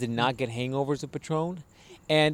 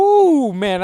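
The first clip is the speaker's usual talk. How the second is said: high, loud, drawn-out woo; excited whoop; 12 semitones higher, 11 dB louder than usual. Real, not acted. Recalling his young drinking days, excited whoop. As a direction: let out a big boastful whoop.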